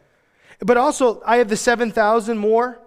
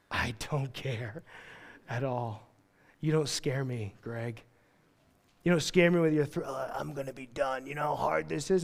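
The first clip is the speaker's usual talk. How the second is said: quiet unbothered God; 6 semitones lower, 12 dB quieter than usual. Retold or imitated. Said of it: "And he's like".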